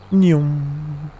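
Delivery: a loud, hammed-up "nyum" munch; mock munching noise. Gamer to his viewers, a loud silly munch. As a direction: smack out a silly eating sound. Gameplay vlog catchphrase noise.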